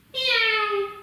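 very high squeaky mock meow; cat meow. Yoga teacher to children in cat pose, high playful meow. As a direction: meow like a little kitten, way up high. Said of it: "breathe out".

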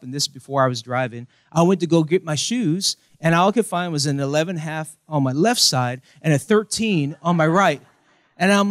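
ordinary talk, nothing marked

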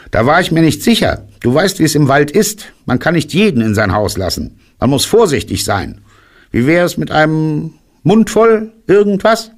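high, fast, fussy rabbit; rabbit character. A narrator's reading. Rabbit justifies caution and offers food, high voice.

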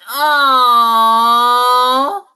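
a huge melting aww, loud and pitched. Real, not acted. Woman meeting the kitten, loud melting aww. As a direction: gush over the kitten at full volume. Cooing over adorable new kitten.

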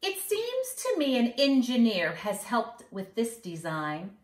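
put-on sing-song rhyme for kids; mischievous leprechaun. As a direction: read it like a cheeky little leprechaun bragging. Leprechaun boasts, reading on.

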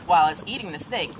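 ordinary talk

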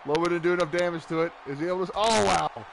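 excited play-by-play call; wrestling commentator. Acted. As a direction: call the match like ringside. Play-by-play of the match.

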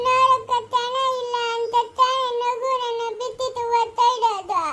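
sing-song weeping; crying. Acted. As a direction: lament through tears, stretching the words. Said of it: "ನೀನು ಅಳೋದು".

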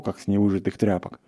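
ordinary delivery, nothing marked

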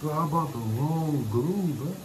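high, wavering dismay; slow high-pitched realization of a blunder; dismayed disbelief. Real, not acted. Vlogger finding he bought wrong glue, dismayed.

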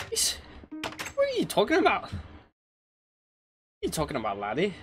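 put-on high-pitched, incredulous Scottish accent. Game streamer retrying the impression without headphones, in a high Scottish accent. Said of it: "Doing a Scottish accent".

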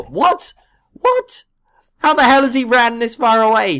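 high loud disbelieving what; outraged astonishment; surprised. Real, not acted. Villager ran far in game.

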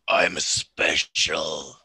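slow deep growl of a smug orc. Acted. Orc to the suspicious cousin, deep and slow. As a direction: drop low and drag it out, proud and dopey.

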